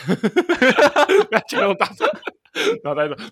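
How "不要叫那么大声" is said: slow, louder words collapsing into laughter; can't get the quote out for laughing; slow laughing imitation of a stranger; laughing through quote. Retelling stranger hushing on phone.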